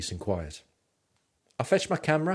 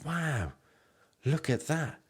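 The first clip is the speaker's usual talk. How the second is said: rising, hammed-up wow, each one higher; mock wowed tourist; 7 semitones higher, slower than usual. Vlogger mocking a constantly amazed tourist, hammed-up wow. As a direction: overdo the amazement more each time. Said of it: "you're not gonna be constantly going".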